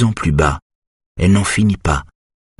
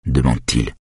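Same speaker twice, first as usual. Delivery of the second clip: plain reading voice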